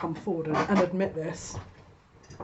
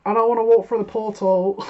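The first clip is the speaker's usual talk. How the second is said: put-on higher, louder childish whine; whiny refusal; 4 semitones higher, 7 dB louder than usual. Stuck querent refusing the portal, whining. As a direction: sulk like a kid refusing to go. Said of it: "they're sat going".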